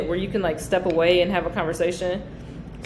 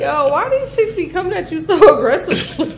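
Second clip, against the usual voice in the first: laughing; 10 semitones higher, 7 dB louder than usual. Real, not acted. Laughing question to her cohost.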